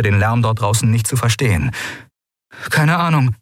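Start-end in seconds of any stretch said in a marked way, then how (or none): none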